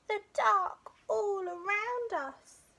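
high small cub voice, drawn out slowly; slow high-pitched fearful cub; little bear cub, slow. A narrator's reading. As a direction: stretch it out like a worried little cub.

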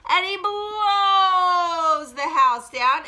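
loud, high, hugely stretched BLOWS; dramatic blowing. Acted. Storyteller blows down the pig's house, loud and drawn out. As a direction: let the verb gust out long and loud.

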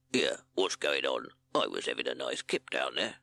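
low, just-woken rat voice; Reckless Rat. A narrator's reading. Rat woken from a nap, low grumble. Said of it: "woke up with a grunt".

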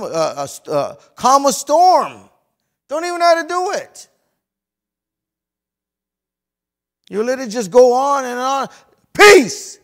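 high, very loud sermon shout; full-force preaching. Acted. Preacher urging the church to calm the storm, full-force shout. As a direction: cry it out to the congregation.

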